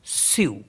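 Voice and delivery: स्यू said softly as s-yu; French cu. Shown as a demonstration. Teacher repeating French cédille word softly. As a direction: pronounce स्यू with an s, lips rounded. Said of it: "सी यू को पढ़ते हैं स्यू".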